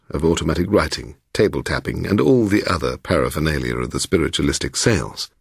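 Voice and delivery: ordinary delivery, nothing marked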